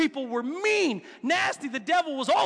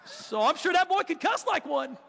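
ordinary talk, nothing marked

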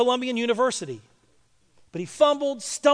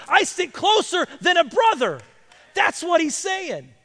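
higher, louder preaching that swells into a shout; full-force preaching; 4 semitones higher, 6 dB louder than usual. Acted. Pastor preaches to the congregation, rising to a shout.

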